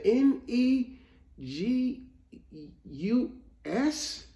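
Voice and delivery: high letters with halting gaps; imitated reluctant spelling. Retelling the boy finishing his spelling, reluctant. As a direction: spell haltingly like a kid expecting to lose. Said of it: "he was reluctant at that point".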